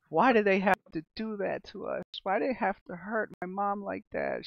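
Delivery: a hint of a sobbing daughter; bawling girl. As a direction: plead through tears, lightly played. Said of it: "She's, like, bawling".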